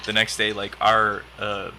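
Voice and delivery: ordinary delivery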